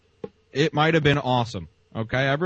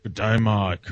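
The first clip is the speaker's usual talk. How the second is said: lower, hammed-up Australian drawl; Dean's Australian accent; 5 semitones lower, slower than usual. Imitating the Australian caller Dean greeting Mike, low Aussie voice. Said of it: "your Australian impression".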